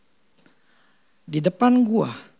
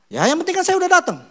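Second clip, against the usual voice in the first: higher and fast, brushing it off; dismissive excuse; 9 semitones higher, much faster than usual. Retold or imitated. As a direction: shrug it off defensively. Late man brushes off the question.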